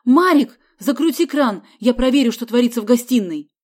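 loud, urgent orders shouted out; shouting. A narrator's reading. Man orders brother to shut tap, shouting. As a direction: call out high and hard in alarm. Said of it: "закричал Лаврентий Самсонович".

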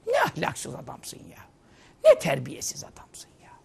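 soft, slow, breathy rebuke; hushed scolding. Real, not acted. Preacher quietly scolding the husband who yells at his wife. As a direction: shame him in a hushed voice.

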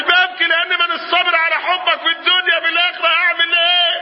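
high, sustained wailing cry; tearful plea. Acted. Servant explains his weeping to the Prophet, in an impassioned wail. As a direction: wail it out on a high, held pitch. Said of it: "بتبكي ليه؟".

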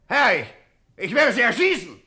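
high, loud stammering threat; furious threat. Acted. Crime boss to the supposed ghost, high loud stammered threat. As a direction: spit out the threat in panic and rage.

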